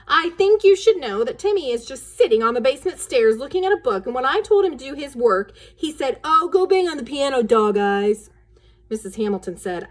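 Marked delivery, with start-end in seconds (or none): 0.1-8.3 loud, high dramatic tattle; tattling announcement. Acted. Daughter barges in to tattle on brother, loud. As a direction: burst in to report on your brother. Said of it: "Wendy announced".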